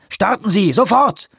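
hoch und drängend gerufen; drängender Ruf. Acted. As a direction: treib den Piloten hoch und laut an. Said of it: "und rief". (drängender Ruf an den Piloten)

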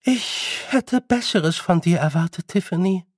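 haughty queen, disappointed. Acted. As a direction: reprove her with cool authority. Queen figure. (hoheitsvoll tadelnde Königin)

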